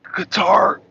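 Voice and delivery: ordinary talk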